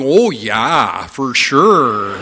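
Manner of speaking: exaggerated Canadian accent, slow and drawn out; mock a Canadian saying it; Canadian accent impersonation. Daughter-in-law mimicking me at the dinner table, exaggerated Canadian accent. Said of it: "started impersonating me at the dinner table".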